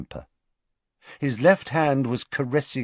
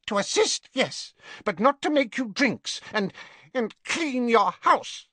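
whiny Wormtail. A narrator's reading.

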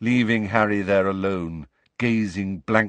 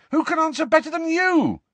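very high, loud outburst; exasperated; 5 dB louder than usual. A narrator's reading. Harry demands answers from the dead Nick, exasperated. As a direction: cry out in exasperation.

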